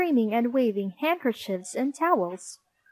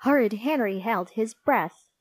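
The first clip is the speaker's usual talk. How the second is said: ordinary delivery, nothing marked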